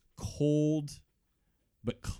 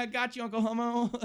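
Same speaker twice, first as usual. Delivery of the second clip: put-on much higher child voice, laughing; giggling little kid; 3 dB louder than usual. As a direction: crow it like a proud kid.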